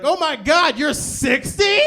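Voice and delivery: loud, high, slow gushing amazement; gushing praise. Retold or imitated. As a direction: rave over him like a fan. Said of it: "they're like".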